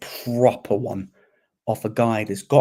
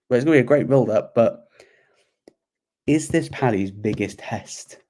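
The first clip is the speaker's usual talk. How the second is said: usual speaking voice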